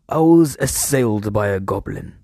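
normal talking voice

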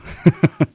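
short bright laugh; quick amused laugh. Real, not acted. Laughs after own answer.

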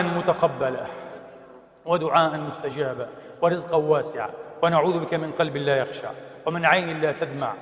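soft, low supplication voice; closing prayer. Acted. (دعاء خاشع بصوت منخفض)

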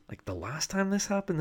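high disbelieving repeat; incredulous echo. Real, not acted. Podcaster repeating the lady's words, incredulous. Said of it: "This crazy shit's already happened".

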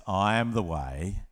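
quiet reverent recitation; solemn Jesus. Retold or imitated. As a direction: let each phrase land with pauses. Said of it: "Jesus says".

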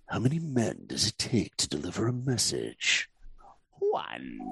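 low, cold menacing villain; Shan Yu villain voice. Shown as a demonstration. Director voices villain line for podcast hosts, low and menacing. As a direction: make each word a threat. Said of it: "Here we go".

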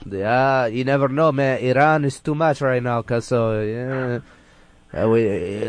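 ordinary delivery

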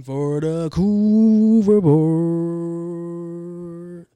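very slow, swelling loud announcement; announcer intro. Acted. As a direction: stretch it out like a game show host. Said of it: "announcing segment title".

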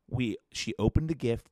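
ordinary talk

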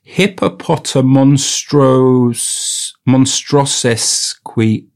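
very slow, stretched syllables; sounding it out. Real, not acted. Retaking a very long word, sounding it out. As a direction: stall and stretch through each chunk.

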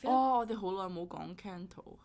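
normal talking voice

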